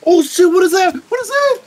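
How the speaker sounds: very high, loud shrieks of alarm; panicked screaming. Real, not acted. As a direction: scream for your teammates to save you. Attacked in game, calls for help.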